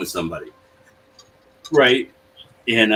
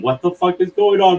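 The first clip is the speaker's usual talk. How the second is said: higher, puzzled what-the-hell; groggy bewildered outburst; 9 semitones higher than usual. Retold or imitated. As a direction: sound like you just woke into chaos. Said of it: "I'm just starting to wake up going".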